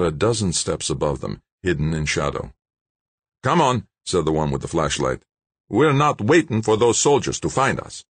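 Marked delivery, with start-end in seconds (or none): 3.4-3.9 raised, urgent character voice; urging gunman. A narrator's reading. Gunman hurries his partner, urgent. 5.7-8.0 high, insistent character voice; impatient gunman. A narrator's reading. Gunman hurries his partner away, impatient.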